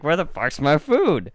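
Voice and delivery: a dog who expected a treat and got none; voicing the dog. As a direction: demand the food like a cheated dog. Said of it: "He's like".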